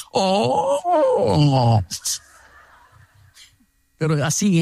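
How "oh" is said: a drawn, sing-song mock "Oh"; hammed-up sarcastic oh. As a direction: imitate his sarcastic sigh. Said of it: "en ese tono".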